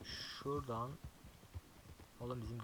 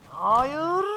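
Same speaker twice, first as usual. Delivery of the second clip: panicked; 13 semitones higher, 15 dB louder than usual. Real, not acted. Wolves attack in game.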